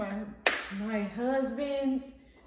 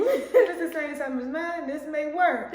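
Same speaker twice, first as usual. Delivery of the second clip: laughing; 4 semitones higher, 7 dB louder than usual. Retold or imitated. Mock pickup line.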